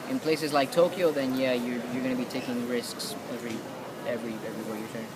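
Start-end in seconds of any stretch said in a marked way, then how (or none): none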